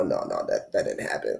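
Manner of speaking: low, hushed mock denial; playful muttered refusal. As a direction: mutter it away under your breath. Denying a breakup in quote.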